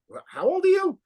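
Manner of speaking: put-on can't believe how young he is; incredulous. Asking the young guitarist his age, high with disbelief.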